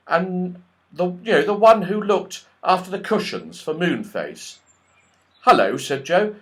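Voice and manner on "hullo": a hint of eager Joe; lightly voiced boy. Joe greets the squirrel, lightly voiced. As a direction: greet him brightly.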